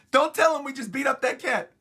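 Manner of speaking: high, loud mock panic; hammed-up alarmed protest; panicked hushing. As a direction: frantically cut someone off, loudly. Usopp panics, acted.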